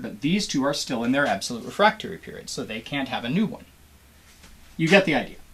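usual speaking voice

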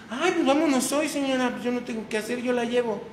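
high, bright eager friend; high, eager imitation of a friend; eager Susana. Voicing Susana's eager reply.

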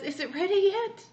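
high, loud chirpy question; high loud eager asking; eager bird voice. Acted. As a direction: ask like an impatient little bird. Bird repeats.

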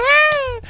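short, very high, loud shriek; sudden squealed "ah"; startled yelp. Real, not acted. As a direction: yelp as it goes wrong. Sudden mishap while playing.

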